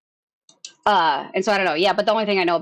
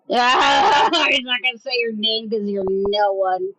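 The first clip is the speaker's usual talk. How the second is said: taunting shout; slower than usual. Real, not acted. Taunting the other streamer.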